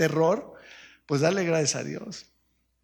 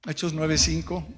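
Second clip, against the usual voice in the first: usual speaking voice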